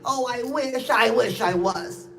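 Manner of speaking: normal talking voice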